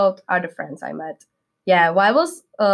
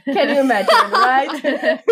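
giggly and higher, laughing at the memory; 6 semitones higher than usual. Real, not acted. Student telling hosts about the party, laughing. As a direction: laugh it out, inviting them in. Recalls open-bar boat party.

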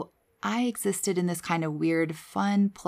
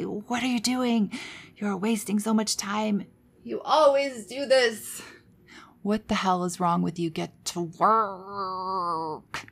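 rageful inner voice; 2 semitones higher, slower than usual. Retold or imitated. Old inner critic berating her for wasting time, understated anger. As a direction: scold yourself with a held-back, bitter edge. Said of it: "screaming, rageful, angry voice".

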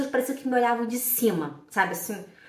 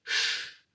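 chiado “sh” isolado; som de sh; 5 dB quieter than usual. Shown as a demonstration. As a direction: demonstre o som sh sem voz. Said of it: "ele tem som de sh". (chiado de “x”)

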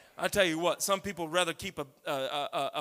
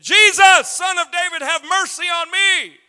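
beggar shouting out to Jesus, much higher and louder; desperate cry; 11 semitones higher, 16 dB louder, slower than usual. Acted. Blind beggar calls out to Jesus, desperate loud cry. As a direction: cry out for mercy at full voice, slowing on the last words.

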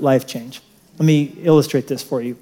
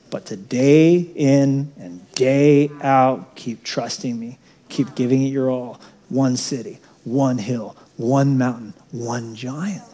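much softer, lower and slow; quiet earnest sermon; 4 semitones lower, 6 dB quieter, slower than usual. Real, not acted. Preacher urging faith softly, low slow hush. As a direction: draw them in with a low hush.

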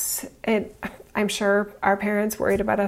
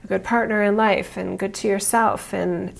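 usual speaking voice

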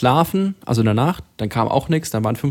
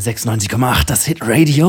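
louder, punchy station ident; radio jingle voice; 4 dB louder than usual. Shown as a demonstration. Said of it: "mit richtig viel Druck dahinter".

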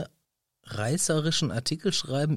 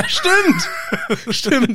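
lachend; 11 semitones higher, 4 dB louder than usual. Real, not acted. Lachend hoch.

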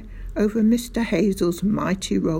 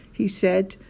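ordinary delivery, nothing marked

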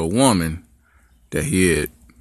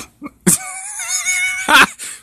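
squealing laugh; high laugh. Real, not acted. Laughs at own story.

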